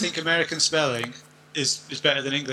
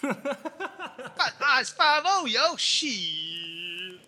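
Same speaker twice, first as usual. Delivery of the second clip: very high, drawn-out Baltimore street call; hammed-up Baltimore projects lookout; Baltimore accent; 11 semitones higher, much slower than usual. Shown as a demonstration.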